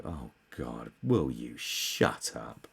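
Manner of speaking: soft, slow exasperated groan; weary mutter. Retold or imitated. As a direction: drag it out, fed up. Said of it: "you just go".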